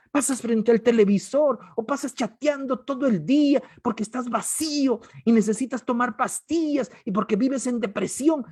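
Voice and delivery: loud, high, driving sermon delivery; full-force revival preaching; fiery preaching. Acted. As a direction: hammer each line home to the crowd.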